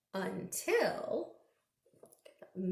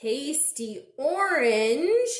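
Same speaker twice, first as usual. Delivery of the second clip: slow, louder drawn-out clue; slow teasing; 3 semitones higher, 6 dB louder, slower than usual. Acted. Reading a clue to kids, slow and teasing. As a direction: stretch it to build suspense.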